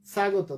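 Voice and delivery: স্বাগত starting with s, not sh; wrong dental s. Shown as a demonstration. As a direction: show the mistake, স্বাগত as sa-go-to. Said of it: "তা তো না". (স্বাগত, দন্ত স-এর ভুল উচ্চারণ)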